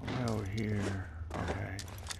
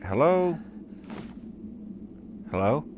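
searching call, lifted at the end; 5 semitones higher than usual. Pretend. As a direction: call out to see who's there. Calling out to unseen people.

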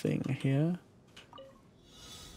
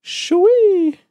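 loud, high whoop; excited shoo-wee; 11 dB louder than usual. Real, not acted. Gamer hollers loud and high at a big jump. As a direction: holler it out in delight.

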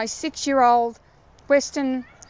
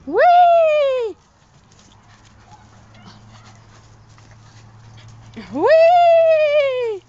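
high, loud gleeful whee; excited squeal; 8 dB louder than usual. Real, not acted. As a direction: squeal with glee at play. Playing with dog.

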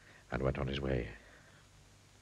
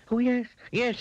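high, louder flustered yes; panicked cry; 5 dB louder than usual. A narrator's reading. Mister Mean remembering the wizard's words, panicked. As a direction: cry out agreement, pitched high. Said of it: "he, he cried".

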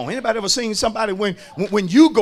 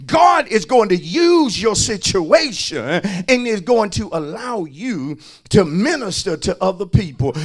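ordinary talk

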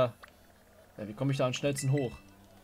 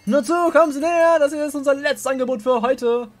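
auctioneer; 7 semitones higher, 4 dB louder than usual. Acted. Auctioneer calling out to crowd.

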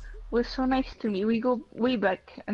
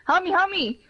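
raised, urgent cry to teammates; calling for help; 6 semitones higher, 3 dB louder, slower than usual. Real, not acted. Player in trouble calls his squad for help, raised and urgent. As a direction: yell for backup.

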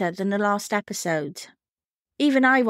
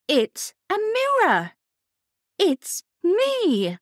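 high, slow, baffled voice; lost and puzzled, drawn out; blank incomprehension; 9 semitones higher, slower than usual. Retold or imitated. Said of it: "utterly confused".

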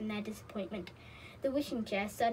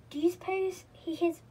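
higher-pitched worried question; understated dismay, starting high; upset; 6 semitones higher, 4 dB louder than usual. A narrator's reading. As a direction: ask it with mild upset. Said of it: "said Molly, feeling upset".

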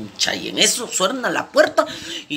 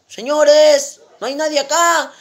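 high, raised calling voice; 10 semitones higher, 6 dB louder, slower than usual. Acted. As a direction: shout for service at an empty station. Llama otra vez en grifo vacío. (llamando a voces, en tono agudo)

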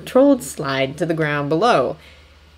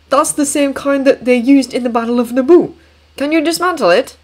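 excited; 8 semitones higher, 5 dB louder than usual. Acted. Obi-Wan's satisfied reply.

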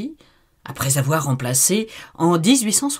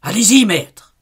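cri d'encouragement aigu; 7 semitones higher, 8 dB louder than usual. Acted. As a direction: pousse son maître en criant. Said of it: "criait Asmodée". (cri d'encouragement)

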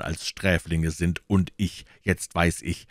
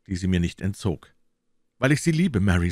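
ordinary delivery

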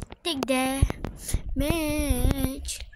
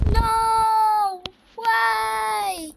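alarmed shout; 4 semitones higher, 5 dB louder than usual. Real, not acted. Sky suddenly turns dark in game.

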